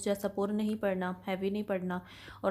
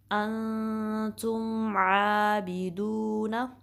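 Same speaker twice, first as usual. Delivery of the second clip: slow melodic अंतुम आबिदूना with a rising end; tajweed recitation; much slower than usual. Shown as a demonstration. Teacher reciting the phrase for students, slowly. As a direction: hold the mim steady, then lift आबिदूना. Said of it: "मीम साकिन पे कलकला नहीं करना".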